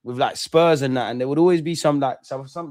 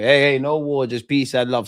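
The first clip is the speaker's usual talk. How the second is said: ordinary talk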